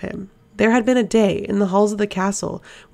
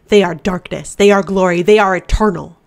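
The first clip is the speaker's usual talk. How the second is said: louder, swelling demonic chant of glory; demon's fervent praise; 9 dB louder than usual. Acted. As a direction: let the demon preach its masters' glory. Demon exalts the Valg princes.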